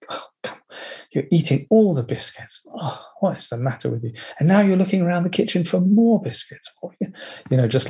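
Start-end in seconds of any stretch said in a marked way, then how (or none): 0.1-0.6 breathy exasperated whisper; whispered scolding. Retold or imitated. Slave driver whispering at herself over yoga. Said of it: "the slave driver". 1.1-6.7 higher, nagging exasperation; exasperated nag. Retold or imitated. Inner slave driver nagging about biscuits, exasperated. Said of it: "constantly exasperated".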